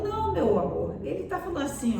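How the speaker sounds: high, coaxing mother's voice; acted soothing wife, sweetly high; tender mother. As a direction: gently correct your husband, pitched high. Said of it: "A mãe pegou aquele mesmo telegrama e ela falou".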